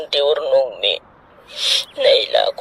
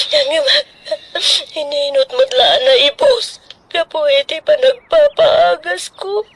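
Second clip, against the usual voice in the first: tearful pleading with a voice pitched up; crying; 10 semitones higher than usual. Acted. Grieving woman crying to her child, tearful and high. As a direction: sob the words out, high and strained.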